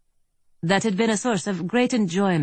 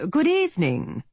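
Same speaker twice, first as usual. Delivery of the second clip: well-to-do British gentleman, pleasant tenor, pitched higher; polished, courteous British greeting; British accent; 12 semitones higher than usual. A narrator's reading. Briton outside greets the marshal in a polished British accent, higher. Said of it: "This accent was British".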